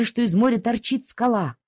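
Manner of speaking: ordinary delivery, nothing marked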